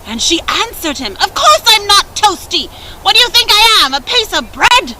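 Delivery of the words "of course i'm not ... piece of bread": high, loud and rude; sour stepsister snapping. A narrator's reading. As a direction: snap back rudely.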